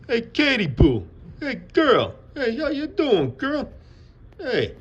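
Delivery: very high, loud mock New York accent; attempted New York accent. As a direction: greet her in a squealed, uncertain New York accent. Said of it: "is that a New York accent?".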